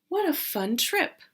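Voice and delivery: ordinary delivery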